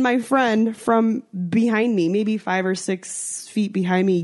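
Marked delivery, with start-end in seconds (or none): none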